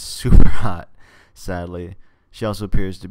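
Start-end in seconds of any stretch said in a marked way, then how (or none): 0.0-0.9 breaks into a loud laugh mid-line; can't keep a straight face on the confession. Real, not acted. Reader laughing while admitting a crush on Jenny.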